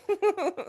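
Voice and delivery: a giggle pitched well up; quiet high laughter; high real laugh. Real, not acted. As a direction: laugh at your own story. Laughing at the story.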